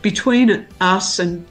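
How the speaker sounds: ordinary delivery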